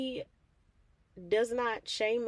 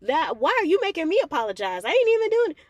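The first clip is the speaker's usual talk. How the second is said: fast complaint rising to a loud, higher indignant outburst; a child refusing to apologize, getting louder; whiny protest; 6 semitones higher, 10 dB louder, faster than usual. Retold or imitated. Speaker protesting to God about apologizing, fast and indignant. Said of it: "I would say this all the time".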